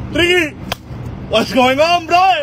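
loud, high hail; calling out loud. Acted. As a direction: shout a greeting across the room. Calling out to Ricky.

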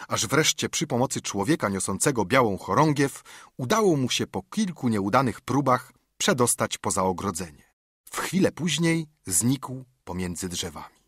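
ordinary delivery

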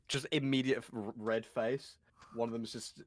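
usual speaking voice

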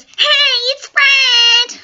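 very high, loud squealed greeting; Fred. Retold or imitated. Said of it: "the one that everybody knows".

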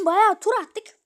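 high, loud shout; yelled complaint. Real, not acted. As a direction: holler it in frustration. Shouting after long chase.

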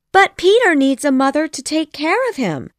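high, insistent protest; crying out. A narrator's reading. Wendy protests to her mother, high outcry. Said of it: "she cried".

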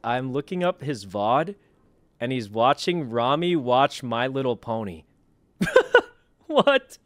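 ordinary talk, nothing marked

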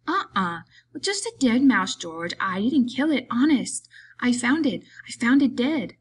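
high, swooping, defensive big man; childlike simpleton, pleading. A narrator's reading. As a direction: protest innocence like an overgrown child. Story figure Lennie.

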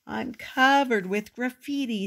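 a wounded little sniff. A narrator's reading. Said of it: "sniffed Victor".

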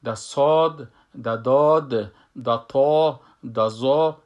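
each heavy letter said loud and full in the mouth. Shown as a demonstration. Teacher drills the four heavy letters, loud and full. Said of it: "ای هر چهار از این مفخم هستن".

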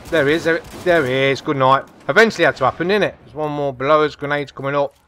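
ordinary delivery

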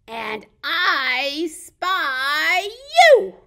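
loud, very drawn-out, high finish; reading to children. Pretend. Ending the book for toddlers, big playful reveal. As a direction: stretch it out big to the listeners.

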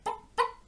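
two short popping clucks; little hen pops; hen clucking. A narrator's reading. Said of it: "And the hen said".

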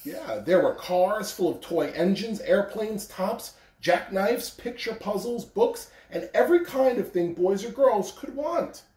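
gentle storybook reading; reading to children. A narrator's reading. Principal reading The Little Engine That Could to children, gentle. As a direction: read it like a picture book to kids. Reading a picture book aloud.

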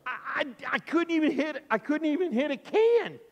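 high, loud stammering protest; upset. Acted. Boy protests after killing grandma's duck, upset. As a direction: act out a distressed child pleading innocence. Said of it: "He was so sad, and he felt bad".

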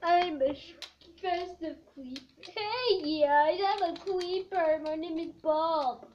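loud cheerful creeper voice; creeper Bob. Acted. Festive creeper introduces himself, loud and proud. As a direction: announce yourself proudly as a creeper.

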